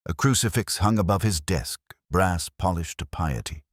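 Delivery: ordinary delivery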